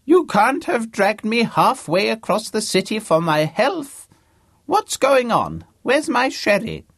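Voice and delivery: put-on high, loud, querulous old-man voice; crotchety old man. A narrator's reading. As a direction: complain like a demanding ninety-year-old. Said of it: "his voice loud, old, and very convincing".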